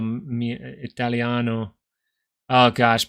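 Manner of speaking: ordinary delivery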